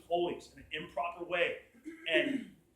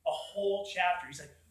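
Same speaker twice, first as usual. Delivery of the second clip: normal talking voice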